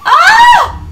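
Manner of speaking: high sudden shriek; excited shriek. Real, not acted. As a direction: let out a sharp excited yelp. Shout at game moment.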